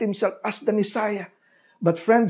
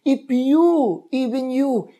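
raised, aching voice for Jesus weeping over the city; lamenting plea; 6 semitones higher, slower than usual. Acted. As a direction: plead like a grieving prophet.

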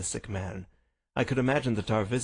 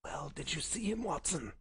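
soft, higher, sickly voice; feeble but crisp; 6 semitones higher, 7 dB quieter than usual. Acted. Sick Holmes questions Watson on his return, feeble voice. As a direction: speak weakly, keeping the words crisp. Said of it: "he spoke in a feeble voice".

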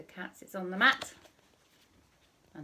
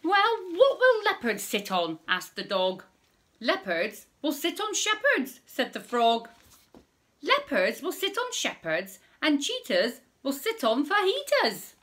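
put-on reading to children; 7 semitones higher, 7 dB louder than usual. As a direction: read it out big for little listeners. Reading rhyming story to children.

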